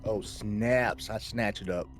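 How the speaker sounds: ordinary talk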